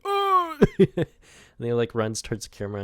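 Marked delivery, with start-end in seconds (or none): 0.0-0.6 sudden high, loud mock cry; pushed kid's cry. Acting out the kid getting pushed, high loud yelp. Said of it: "it's just like".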